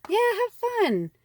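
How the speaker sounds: high, loud "have fun"; excited repeat. Shown as a demonstration. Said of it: "So the next card is have fun".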